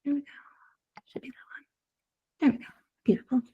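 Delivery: whispered to herself while fixing the screen; whispered aside. Real, not acted.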